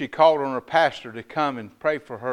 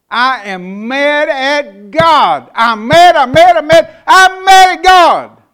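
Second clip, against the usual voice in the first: high, loud, slow wail of anger; furious grief; 12 semitones higher, 18 dB louder, slower than usual. Retold or imitated. Grandmother raging at God in the funeral line, loud wail. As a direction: hurl it at God through grief.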